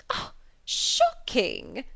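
very slow, mock-scandalised gasp of shock; hammed-up ladylike outrage; shocked cry. A narrator's reading. Miss Bingley feigning shock at Darcy's remark, drawn-out cry. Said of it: "cried Miss Bingley".

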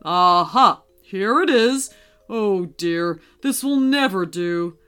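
hearty find turning to concern; a bold aha, then dismay; storybook Santa checking his list; Santa, discovering then disapproving. Acted. Narrator voices Santa reading list.

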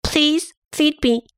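put-on childlike tummy asking for food; hungry tummy voice. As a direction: ask sweetly to be fed like a hungry belly. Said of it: "It is saying".

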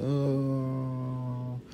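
soft, long "ohhh" held as if dropping away; faraway slow-motion "ohhh"; drawn-out falling "oh". Pretend. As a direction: let out a quiet, stretched falling moan. Mimics falling down the hole.

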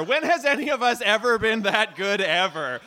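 normal talking voice

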